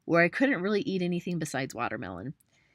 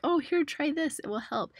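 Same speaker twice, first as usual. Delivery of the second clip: much higher, mock eager helper voice; pushy helper; 10 semitones higher than usual. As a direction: mimic someone pushing a cure on you. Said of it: "they're like".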